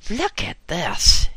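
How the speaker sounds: woman yelling at her friend over a newspaper, slow and loud; yelled. A narrator's reading. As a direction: thrust the paper and bellow it. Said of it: "yelled Martha".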